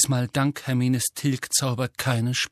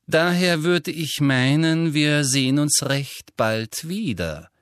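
Umbridge voice; 3 semitones higher than usual. Acted.

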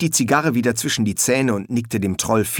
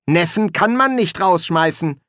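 high-pitched, aggrieved objection; indignant nephew's protest; 8 semitones higher, 3 dB louder than usual. Acted. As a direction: insist it simply isn't allowed. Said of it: "protestierte er".

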